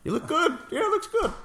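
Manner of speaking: falsely cheerful, way up high; high fake reassuring voice. Mock reassuring about his face.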